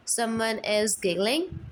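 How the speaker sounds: usual speaking voice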